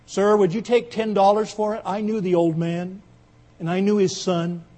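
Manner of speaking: loud plea from the back; calling out. Acted. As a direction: call across the room to the auctioneer. Man calls from back of room.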